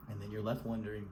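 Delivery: normal talking voice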